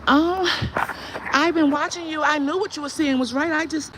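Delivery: high, loud mocking voice of a fake fan. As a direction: parody the lurkers' sweet talk. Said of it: "Talking about".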